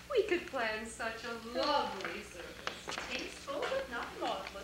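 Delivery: soft, airy musing; picture it softly, almost to yourself; hushed reverie. Acted. Mourner planning a tasteful funeral, hushed reverie. Imagines a lovely funeral service.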